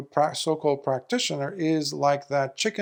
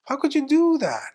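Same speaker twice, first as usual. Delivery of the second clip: high, sharp scolding question; 11 semitones higher than usual. Retold or imitated. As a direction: demand an answer in disbelief. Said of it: "Lord Buddha scolds him".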